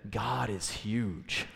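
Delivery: soft, slow, low "God is huge"; mock hushed awe. Church member answering the pastor's question, hushed and slow. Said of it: "one person in the group said".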